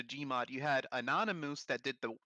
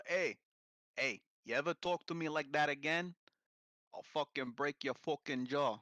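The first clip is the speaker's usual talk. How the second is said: mock British thug; 9 dB louder than usual. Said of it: "doing accents by mistake".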